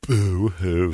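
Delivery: mock sob, low and soft; sarcastic little boo-hoo; fake crying. Mocking pain of birth.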